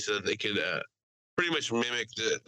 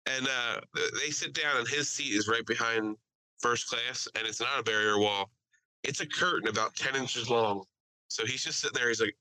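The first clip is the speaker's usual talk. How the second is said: usual speaking voice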